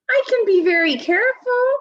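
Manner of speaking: high and loud, eager promise; little sister begging to join in; high, loud pleading child voice; pleading little girl. Acted.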